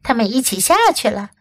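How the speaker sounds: usual speaking voice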